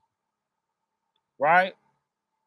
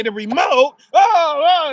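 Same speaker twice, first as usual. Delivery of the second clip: higher and louder indignant outcry; mock exasperation; 6 semitones higher, 7 dB louder than usual. People balking at God's small request, higher and louder in mock outrage. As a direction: ham up the put-upon complaint. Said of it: "a lot of people are gonna say".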